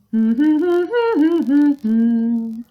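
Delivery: loud high hum. Shown as a demonstration. Teacher hums for the class. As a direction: hum a bright tune. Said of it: "I can hum".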